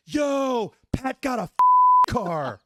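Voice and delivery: high, loud jeering call across the room; mocking shout. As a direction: yell it to roast him. Barbini mocks the car aloud.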